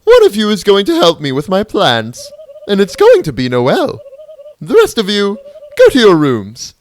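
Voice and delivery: loud, high, haughty queen; regal villain voice. Acted. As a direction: gloat like a grand robot monarch. Queen ordering.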